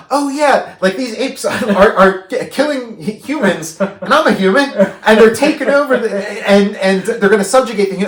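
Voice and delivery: high, loud dawning alarm with laughter; mock sudden realization. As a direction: blurt it out as if it just hit you. Acting out the viewer's sudden realization.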